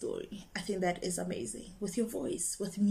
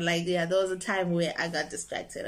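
ordinary talk

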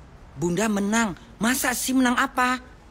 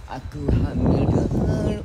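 louder, burst out the confession; loud dramatic blurt; 3 semitones lower, 5 dB louder, much slower than usual. Pretend. Soap-opera heroine blurting out her pregnancy, loud. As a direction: throw the line out like a climax. Final punchy take.

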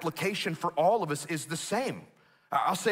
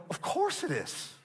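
high mock reply; 10 semitones higher, 6 dB quieter than usual. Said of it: "I always respond and go".

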